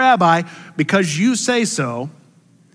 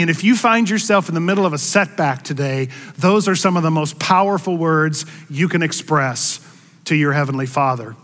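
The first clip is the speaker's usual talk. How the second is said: usual speaking voice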